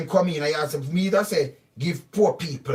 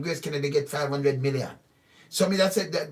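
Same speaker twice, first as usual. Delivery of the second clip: ordinary talk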